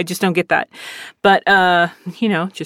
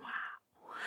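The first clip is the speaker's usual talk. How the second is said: whispered wow; 28 dB quieter than usual. Retold or imitated. Hearing about combative student.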